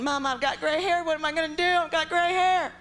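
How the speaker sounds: high, rising panic to mom; panicky whine. Retold or imitated. Daughter panicking to mom on the phone, high whine. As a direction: whine it like a scared kid on the phone.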